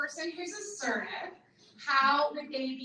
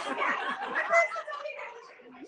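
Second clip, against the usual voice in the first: laughing; 13 semitones higher, 4 dB louder than usual. Retold or imitated. Laughing recap of mom's setup.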